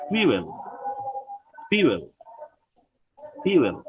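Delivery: ফিউয়েল as "FYOO-el", falling each time; ফিউয়েল with a y-glide after the f; model pronunciation. Shown as a demonstration. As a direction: drill ফিউয়েল with pauses for repeating. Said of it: "এটা উচ্চারণ কি?".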